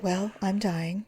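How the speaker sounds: soft, slow deadpan; mock doom. Wife telling her husband the news in a soft, slow mock-doom voice. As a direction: deliver it quiet and slow, fake tragic. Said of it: "came downstairs and said".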